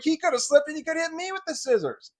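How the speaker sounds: put-on boy throws the stepmother's words back at her; indignant retort. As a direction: fire it back incredulous.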